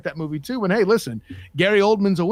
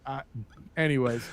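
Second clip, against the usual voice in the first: ordinary talk, nothing marked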